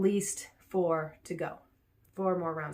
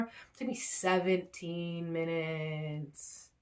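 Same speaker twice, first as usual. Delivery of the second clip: slow deadpan; much slower than usual. Real, not acted.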